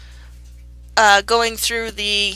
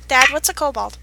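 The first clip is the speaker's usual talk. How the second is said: normal talking voice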